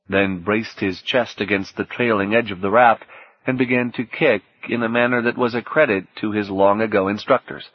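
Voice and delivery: plain reading voice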